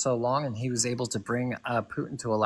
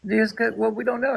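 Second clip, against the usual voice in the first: higher and louder, blurting defensively; heated outburst; 7 semitones higher, 5 dB louder than usual. Real, not acted. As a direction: burst out in protest. Heated debate, defending Trump.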